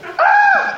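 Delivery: very high excited shriek; excited squeal. Acted. Rhoda sees Peter at the door, a high excited shriek. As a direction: shriek with startled joy.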